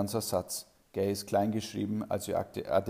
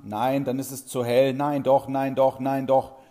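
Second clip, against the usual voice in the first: mock pupil squabble; 4 semitones higher, 9 dB louder than usual. Pupils bicker back and forth.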